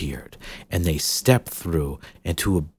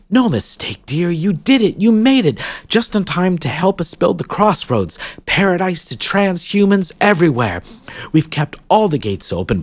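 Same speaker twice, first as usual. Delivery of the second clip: higher, cheerful insistence; lightly voiced eager hostess; 9 semitones higher, 5 dB louder than usual. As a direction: reassure her brightly. Ceridwen reassures Jill.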